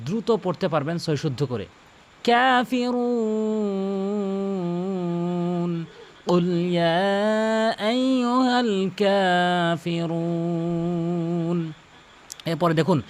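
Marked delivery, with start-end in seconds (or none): 2.2-11.7 very slow melodic tilawat; slow Quranic chant; Quran recitation. Acted. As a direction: chant the verse in full tajwid. Recites the surah.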